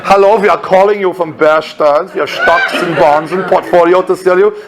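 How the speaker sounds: hammed-up German accent sales call. Intern selling stocks and bonds by phone, mock German accent. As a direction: sell it in a stage German accent. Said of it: "and your German accent".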